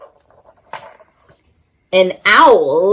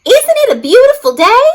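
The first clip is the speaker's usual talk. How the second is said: much higher, louder cheerful tumbleweed voice; Rosie calling out; 13 semitones higher, 5 dB louder, faster than usual. Acted. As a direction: call out bright and high like a bubbly tumbleweed. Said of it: "Rosie the Tumbleweed called out".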